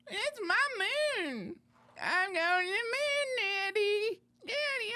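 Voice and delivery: put-on very high, whiny little kid voice; excited child. Child crying to Daddy about going to the moon, high and whiny. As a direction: squeal like a kid begging Daddy.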